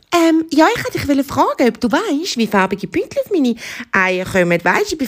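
high-pitched, earnest hare voice; little hare. Acted. Hare asks the zebra for help, in high little voice. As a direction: ask eagerly as a small hare.

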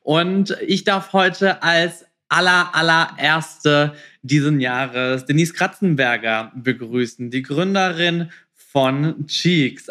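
ordinary talk, nothing marked

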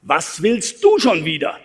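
slow, leaning on "du"; stress on du. Shown as a demonstration. Speaker shows the audience how stress shifts meaning, hitting "du". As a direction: press "du" as if singling out the person. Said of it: "wie wichtig Betonung ist".

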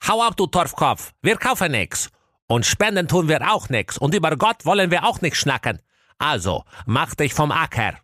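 high-pitched scolding shrew; hostile farrier woman. A narrator's reading. Wicked farrier turning away a caller, high scolding voice. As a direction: send the caller packing in a high voice. Voices Belinda Hammerbrei refusing entry.

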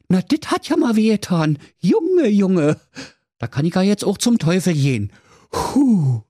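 high, puffing Berlin voice after fiery sauce; burned-mouth hero. Acted. Schnitzelschorsch after the spicy kebab, high puffing voice. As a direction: gasp it out, mouth still burning.

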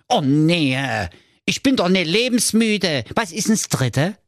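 put-on high-pitched fretful young prince; a pampered prince in a panic; whiny high prince. Prince refuses. (hohe, weinerliche Prinzenstimme)

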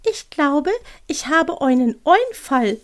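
high little owl with an idea. Acted. Owl calling out its idea, high creature voice. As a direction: announce it slowly in a high owl voice. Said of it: "rief die Stimme".

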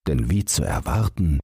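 plain reading voice

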